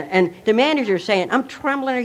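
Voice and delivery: normal talking voice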